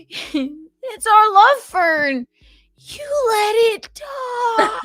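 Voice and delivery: mock dramatic wail. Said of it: "Somebody in the chat went".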